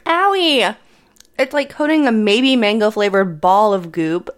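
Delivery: whimpering through spicy burn; mouth burning from spicy food. Real, not acted. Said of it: "the outside hurts".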